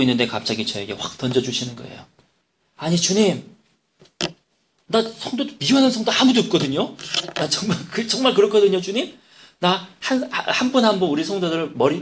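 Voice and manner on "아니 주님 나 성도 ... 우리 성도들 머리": higher, insistent pleading; protesting to God. Retold or imitated. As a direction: argue your case to the Lord, pitch up. Pastor protests to God in prayer. (주님께 높은 음으로 억울하게 항변)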